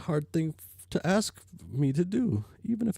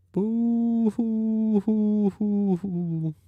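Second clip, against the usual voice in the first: mock ghost sobbing; 5 semitones higher, 5 dB louder, much slower than usual. Jade wails before the stop.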